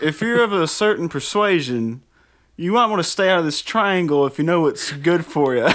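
high, loud forecaster voice; TV weatherman voice. Retold or imitated. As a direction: warn them like a folksy weatherman.

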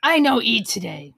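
growling bugbear. Pretend. Zombie Santa.

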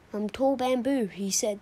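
ordinary delivery, nothing marked

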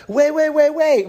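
high, loud rapid-fire imitation of someone halting you; urgent calling out. Acting out gate girl halting him.

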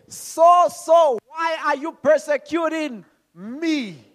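loud, high, slow proclamation; voice from heaven. Acted. Preacher giving what Jesus said to Saul, slow heavenly call. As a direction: stretch each word out, calling from on high. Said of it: "what does he say?".

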